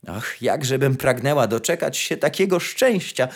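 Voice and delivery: usual speaking voice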